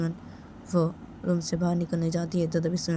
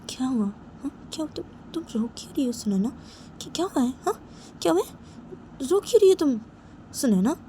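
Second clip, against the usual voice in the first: high-pitched worried questioning; alarmed concern; 7 semitones higher than usual. Acted. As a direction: ask urgently, voice leaping up. Alarmed friend finds her crying.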